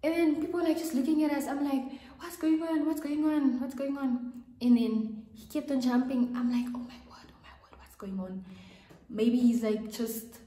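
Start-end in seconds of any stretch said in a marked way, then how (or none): 2.2-4.4 high, urgent repeated question; alarmed, asking again and again; panicked confusion. Retold or imitated. To classmates, confused by his jumping, high and alarmed. 6.7-8.4 breathless whispered disbelief; shocked whisper. Retold or imitated. Watching the classmate jump in class, a shocked whisper.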